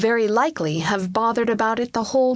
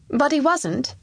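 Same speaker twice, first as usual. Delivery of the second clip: put-on higher, lightly boyish voice; questioning child; 7 semitones higher than usual. Young king questioning the Chancellor, lightly boyish. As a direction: ask it as a child, pitched up.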